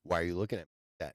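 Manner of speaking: low fast muttered retort; mock annoyed. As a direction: snap back at a stranger. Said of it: "You're like".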